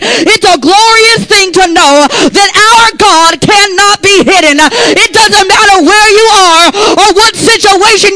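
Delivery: loud, high, shouted preaching; preaching at full force. Acted. Preacher shouting God's glory to the church, full force. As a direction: whoop it up to the congregation.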